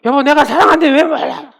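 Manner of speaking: high, loud gruff husband retort. Retold or imitated. Husband snapping at his wife in a harsh tone. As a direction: snap it back at the wife. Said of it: "막 이러잖아요".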